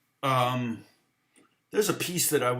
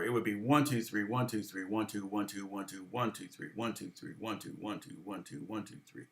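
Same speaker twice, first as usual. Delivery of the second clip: quick counting, «one» rising each time, fading softer; counting subdivided eighths; 4 semitones lower, 8 dB quieter than usual. Shown as a demonstration. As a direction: count out the eighth notes, trailing off. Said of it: "if you subdivide".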